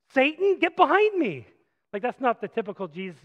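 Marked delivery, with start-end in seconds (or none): 0.1-1.5 much higher and louder command; thunderous rebuke. Acted. Jesus orders Peter away, much higher and louder. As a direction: banish him with full force.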